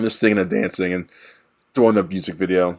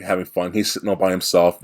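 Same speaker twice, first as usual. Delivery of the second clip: normal talking voice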